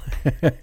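soft chuckle; brief breathy laugh; short quiet laugh. Real, not acted. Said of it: "I don't mean to laugh".

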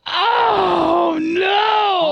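high, loud shout; shocked shout. Real, not acted. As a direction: yell out in real shock. Reacting to friend vomiting.

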